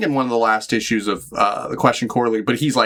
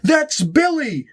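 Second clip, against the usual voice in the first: much higher, slowing on that's Billy; hammed-up panicked plea; mock alarmed cry; much slower than usual. Myra stopping the hero from hitting her boyfriend, high mock plea. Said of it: "And she's like".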